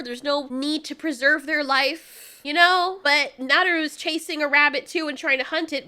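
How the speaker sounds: usual speaking voice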